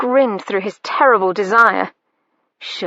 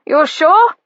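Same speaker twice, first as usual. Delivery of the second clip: louder, higher call through the door; doubtful call; 7 semitones higher, 6 dB louder than usual. A narrator's reading. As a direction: ask loudly from outside. Brother calling through door.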